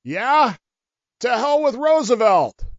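enemy soldier yelling back up the hill; mock yell back. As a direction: fling the insult right back. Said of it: "stood up and yelled".

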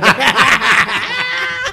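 high, loud burst of laughter. Real, not acted. Laughing about teachers at café.

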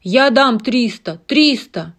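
loud shouted bid; shouting bid. Acted. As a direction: yell the bid over the crowd. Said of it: "Ральф выкрикнул".

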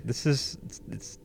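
fighting back tears as he reads; choked up. Real, not acted. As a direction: voice catching with emotion. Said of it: "don't get me all choked up".

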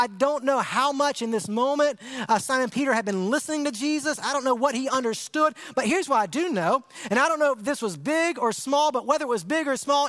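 ordinary talk

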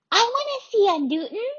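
put-on high, loud childish exclaim; a small child bursting with eagerness; excited little girl. A narrator's reading. Little sister begging to see Newton, high excited shout. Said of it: "exclaimed Lainey".

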